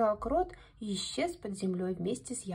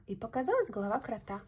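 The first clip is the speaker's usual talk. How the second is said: plain reading voice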